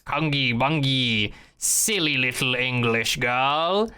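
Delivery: slow, put-on alien voice; imitating an alien's pompous drawl; mock alien speech. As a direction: draw it out like a menacing alien. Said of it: "he's, like, speaking his".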